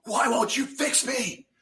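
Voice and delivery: high, strained plea at God, slow; pained cry at God; cursing at God. Retold or imitated. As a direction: cry it out in anguish. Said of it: "I started cursing at God".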